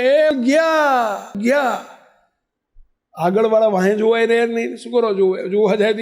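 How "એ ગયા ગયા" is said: retold shouting. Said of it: "વાંહેવાળા બૂમો પાડતા રહ્યા".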